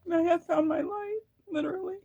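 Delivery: on the edge of real tears, pushing through; tearful. Real, not acted. Tarot reader tearing up over her own healing, high and crying. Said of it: "I'm gonna cry".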